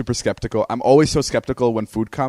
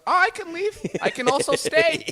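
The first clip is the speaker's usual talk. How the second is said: very high, sing-song mock mom; exaggerated high mother impression; imitating mom. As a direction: squeak it out like a people-pleasing mom. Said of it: "And she goes".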